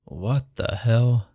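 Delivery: normal talking voice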